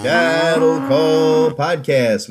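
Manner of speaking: slow, stretched announcer title; host hamming the title; drawn-out show title. Acted. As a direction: lean into the show name, slowly. Host drawls show name.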